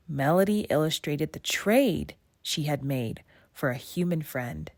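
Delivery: ordinary delivery, nothing marked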